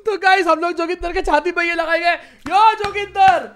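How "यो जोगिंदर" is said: slow, drawn-out, louder catchphrase call; Joginder catchphrase. Retold or imitated. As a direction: call it out like the influencer's signature intro.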